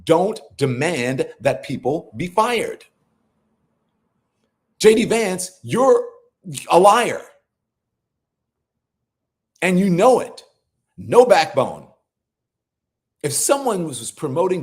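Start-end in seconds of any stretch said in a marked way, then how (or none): 4.8-10.4 loud, pointed accusation, higher; calling him a liar. Real, not acted. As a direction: call him out to his face. Calls Vance a liar directly.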